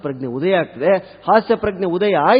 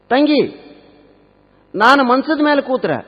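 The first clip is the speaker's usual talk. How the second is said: high, raised interrogating voice; quizzing mother-in-law; 8 semitones higher, 3 dB louder than usual. Acted. Mother questions her son's new wife, high-pitched quizzing.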